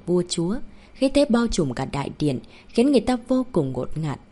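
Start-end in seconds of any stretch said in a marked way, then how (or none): none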